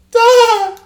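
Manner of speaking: sudden high, loud cartoonish "Duh!" yelp; fake laugh. Shown as a demonstration. Showing off his SpongeBob-like fake laugh, a loud high "Duh!". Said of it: "That's what SpongeBob sounds like".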